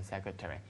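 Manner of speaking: "secretary" in American style, ending in "terry"; American pronunciation. Shown as a demonstration. Teacher repeats what Americans prefer, American pronunciation. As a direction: keep the full vowel in "-tary" of "secretary". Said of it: "美国人比较喜欢读成".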